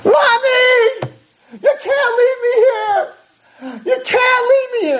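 high, desperate wailing scream. Retold or imitated. Young woman begs parents not to leave, screaming. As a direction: scream and cry out for mommy. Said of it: "this young woman begins to scream".

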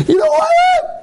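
very high imitated shriek; screaming. Stranger shrieking at a shopper in Target, imitated scream. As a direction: re-enact the stranger's piercing scream. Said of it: "Screaming on top of his lungs".

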